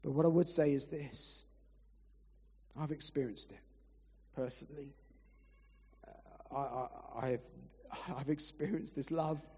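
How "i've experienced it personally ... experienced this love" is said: choked up, voice dropping quiet; fighting back tears as he testifies; real emotion breaking through softly; emotional. Real, not acted. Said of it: "I'm sorry I get emotional".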